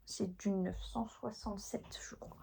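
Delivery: breathy whisper, nearly no voice; whispered aside. Real, not acted. Telling viewers the thread number in a whisper. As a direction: drop to a whisper to read the number.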